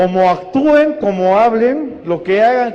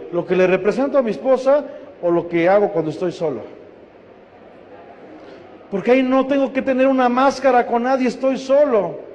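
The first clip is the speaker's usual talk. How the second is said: ordinary talk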